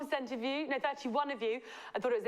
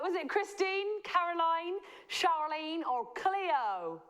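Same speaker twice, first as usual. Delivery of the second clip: higher put-on Southern accent; Southern American accent; 5 semitones higher, slower than usual. Host offering quiz choices, Southern American accent. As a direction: list the names like a Southerner. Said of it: "I should stop with the Southern accent".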